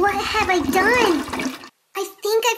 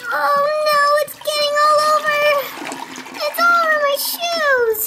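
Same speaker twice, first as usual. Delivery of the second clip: panicked; 6 semitones higher than usual. Acted. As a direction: wail as water hits your shoes. Toilet overflowing onto her.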